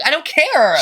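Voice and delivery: high-pitched mock-indignant outburst; indignant retort. Retold schoolyard argument over crawfish.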